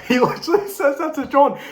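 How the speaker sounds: cracking up in disbelief; laughing. Real, not acted. Recounting the translator's warning, laughing hard.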